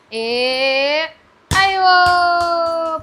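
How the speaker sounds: very slow, drawn-out teasing call; mock warning, stretched out; playful chase call. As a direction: hold the vowels long to tease a toddler. Playful chase game with toddler.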